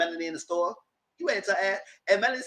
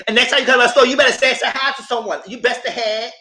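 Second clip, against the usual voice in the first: mock angry threat; 8 semitones higher, 8 dB louder than usual. Quoting Destiny's angry threat.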